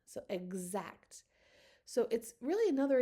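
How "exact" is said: "exact" with X as a buzzing gz; voiced X. Shown as a demonstration. Teacher showing the voiced sound, "exact" said with gz. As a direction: say "exact" slowly, voicing the X as g plus z. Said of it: "make the voiced version of g, z".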